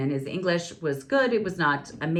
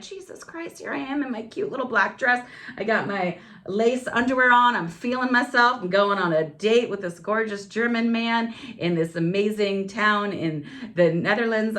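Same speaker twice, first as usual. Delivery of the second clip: exasperated inner voice; 5 semitones higher than usual. Retold or imitated. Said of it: "part of me was like".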